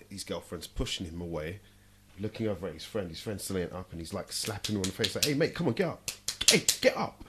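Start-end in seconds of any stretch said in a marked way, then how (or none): 5.3-7.2 urgent calling out. Retold or imitated. Fighter voiced urging knocked-out friend.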